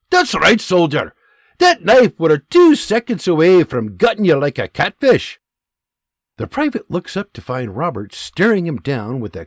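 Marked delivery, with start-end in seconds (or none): 0.1-5.4 loud Scottish scolding; Scottish bark. Acted. Scottish sergeant barking at the shamed private. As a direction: hammer the point home, Scottish. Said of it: "The sergeant barks".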